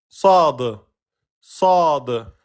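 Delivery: heavy back-mouth Sad; emphatic letter. Shown as a demonstration.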